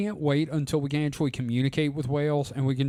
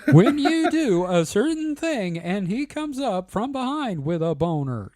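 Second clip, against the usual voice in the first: higher, slow synthetic text-to-speech voice; TikTok computer voice; 7 semitones higher than usual. Retold or imitated. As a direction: read it like the TikTok robot voice. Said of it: "you know, that voice".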